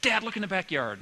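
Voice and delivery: mock kid calling out to Dad; excited child calling. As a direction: call out like an eager child.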